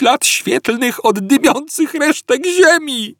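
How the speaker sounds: high, loud indignant rant; Arthur ranting. A narrator's reading. Arthur to Ford, a high indignant rant. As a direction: pour out the grievances.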